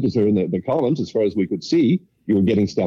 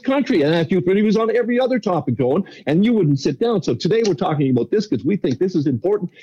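the reporter's rebuke, voiced a lot higher; a higher, firm retelling of the interviewer's line; quoting the reporter; 10 semitones higher than usual. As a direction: replay her firm rebuke pitched up.

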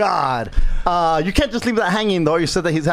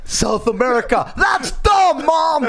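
put-on kid shouting; 12 semitones higher, much slower than usual. Boy mocking his sister to mom, shouting. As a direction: yell it out to mock her.

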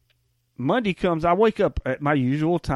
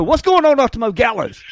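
loud, high, hyped-up hello; big welcome to the co-host; excited greeting; 8 semitones higher, 8 dB louder than usual. Real, not acted.

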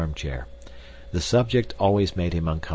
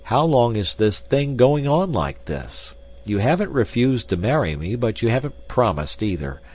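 forceful, louder blunt demand; 6 semitones higher, 5 dB louder than usual. A narrator's reading. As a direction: press for an answer without softening. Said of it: "he said bluntly".

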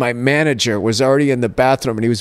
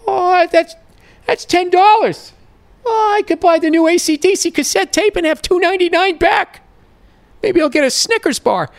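put-on a squeaky, overjoyed dumb kid counting the money; naive kid. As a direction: play his dumb nineteen-year-old self thrilled at fifty cents. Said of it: "And I was like".

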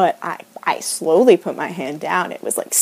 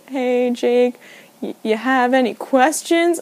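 through tears; 3 semitones higher, slower than usual. Retold or imitated. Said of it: "and says, through tears".

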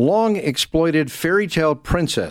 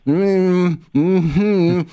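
ventriloquist hum, higher and louder; lips barely moving; 7 semitones higher, 4 dB louder, much slower than usual. Shown as a demonstration. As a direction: mumble without moving your lips. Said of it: "I'll give you an example".

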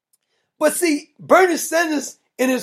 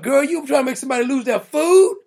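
usual speaking voice

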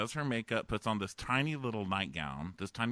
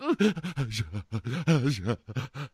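loud, gasping mock sobs; fake ugly cry; 5 semitones higher, 6 dB louder than usual. Contestant dabbing dry eyes, fake ugly crying. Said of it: "an ugly cry you were just faking".

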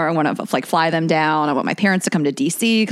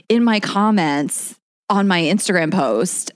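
normal talking voice

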